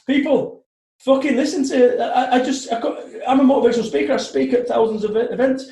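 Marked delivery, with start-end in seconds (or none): none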